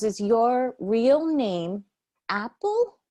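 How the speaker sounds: slow loud patronizing question with a high Apple; condescending customer. Retold or imitated. Imitating a rude customer quizzing the nail tech, slow and patronizing. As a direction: talk down slowly as if to a child. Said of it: "she's talking like that".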